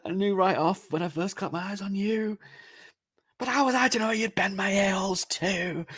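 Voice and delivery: high, put-on unhinged voice; deranged killer. Killer talking to his dead wife, high deranged voice.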